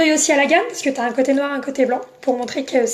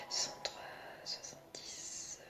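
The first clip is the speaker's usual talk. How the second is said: whispered counting; 28 dB quieter than usual. Real, not acted. Adding up prices under her breath.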